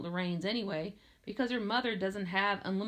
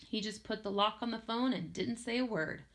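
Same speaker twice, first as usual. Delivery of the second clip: ordinary delivery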